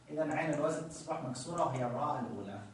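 usual speaking voice

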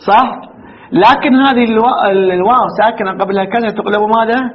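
ordinary talk, nothing marked

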